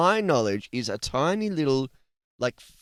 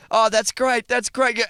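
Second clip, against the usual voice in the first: a hint of a mock woman, higher; 10 semitones higher, 5 dB louder than usual. Host acting out the woman mid-act, mock pleased. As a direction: lightly voice her, pleased. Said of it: "the woman's, like, going".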